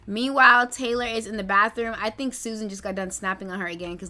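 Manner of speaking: ordinary talk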